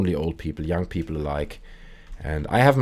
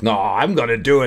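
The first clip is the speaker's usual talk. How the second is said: louder, higher acted retort; defiant insistence; 5 semitones higher, 6 dB louder than usual. Sven answering a dare in the story, defiant insistence. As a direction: insist on it like a mock stubborn drinker.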